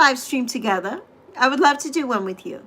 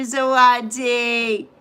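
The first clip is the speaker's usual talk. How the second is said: normal talking voice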